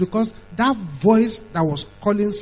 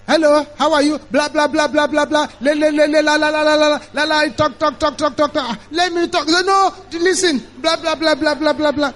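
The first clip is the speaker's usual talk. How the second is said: high, loud babble without a breath; nonstop chatterer; 8 semitones higher, 5 dB louder than usual. Acted. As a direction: act out someone who won't let anyone else talk. Acting out nonstop one-sided talker.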